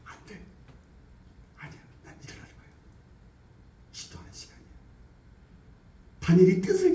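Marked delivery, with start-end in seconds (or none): 0.0-4.6 hushed voiceless self-talk; whispered resolve. Acted. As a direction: whisper your firm decision. Resisting temptation in whisper. (속삭이며 다짐하듯)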